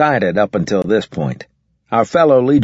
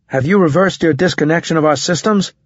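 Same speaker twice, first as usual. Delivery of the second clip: stern; 8 semitones higher than usual. A narrator's reading. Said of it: "I asked sternly".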